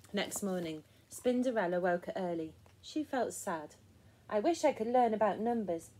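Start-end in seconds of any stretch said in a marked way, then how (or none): none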